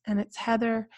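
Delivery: ordinary talk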